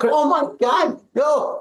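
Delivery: high-pitched mock dismay; retold panic. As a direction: act out alarm, pitched well up.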